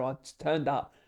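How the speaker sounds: normal talking voice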